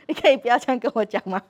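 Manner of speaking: put-on high, loud, fast laughing child voice; mock child retort. Child parroting the parent's words back, in a high laughing mock voice. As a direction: mimic a kid throwing your words back, giggling. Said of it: "他就说".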